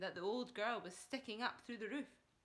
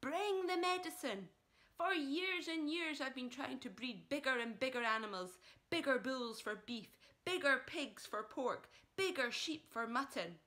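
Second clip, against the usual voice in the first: eager father; 4 semitones higher, 5 dB louder than usual. Acted.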